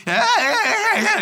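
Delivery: high, loud yeah yeahs; giddy squeals while bumping over grass; whooping. Retold or imitated. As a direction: whoop like a kid on a ride. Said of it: "I'm just".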